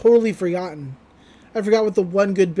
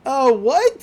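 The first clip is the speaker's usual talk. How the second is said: higher, louder squeaky startled what; imitating a ditzy girl caught off guard; Mako's bewildered what; 5 semitones higher, 6 dB louder than usual. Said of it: "And Mako's like".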